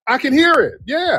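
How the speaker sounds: high-pitched, falling knowing confirmation; knowing agreement. Real, not acted. Host agreeing the woman sounds Dominican, knowing high voice.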